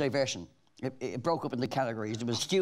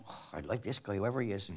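quiet aside; 6 semitones lower, 6 dB quieter than usual. Retold or imitated. Retold inner thought, quiet.

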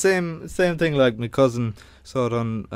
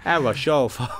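mixed London-Manchester accent imitation; imitated London-Manchester twang. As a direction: attempt Irish phrases in a muddled English accent. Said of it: "London, Manchester accent, he just comes out".

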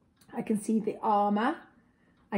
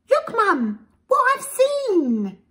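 put-on much higher, eager little girl's call; excited child; 14 semitones higher, 8 dB louder, slower than usual. Little girl tugging her mother's sleeve, high excited call. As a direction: pipe up like a thrilled child.

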